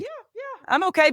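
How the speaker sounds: high-pitched little reassurance; imitating a too-high, unconvincing "all good"; feigned okay. Pretending she is fine when panicking.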